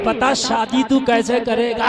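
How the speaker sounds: loud, slow, drawn-out taunting question; shouted challenge. Acted. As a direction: demand an answer at full volume. Climax of acted debate. (ज़ोर से ललकारता सवाल)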